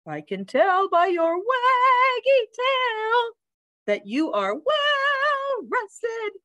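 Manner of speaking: high, slow, warbling sing-song; chirpy bird teacher. A narrator's reading. Bird notices the dog's waggy tail, high sing-song. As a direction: trill it high, stretching key words.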